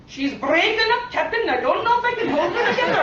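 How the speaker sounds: high, hammed-up starship engineer; dramatic voice from above; ethereal voice. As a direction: call down to the captain in mock alarm. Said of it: "an ethereal voice that said".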